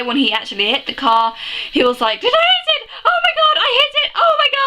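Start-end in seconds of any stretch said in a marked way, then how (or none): 2.2-4.6 very high excited shriek, imitating him; mock ecstatic yelling, pitch way up. Isaac finally hits the car with the egg, excited shrieking.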